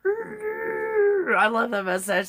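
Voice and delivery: very high, long-drawn squeal; silly gushing squeal. Pretend. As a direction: stretch it out slowly in a silly high voice. Silly repeat of compliment on mustache.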